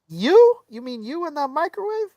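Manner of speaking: high-pitched, disbelieving questions; mock incredulous retort. Diner mocking owner and microwave, high disbelief. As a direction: fire back in a high, scoffing voice.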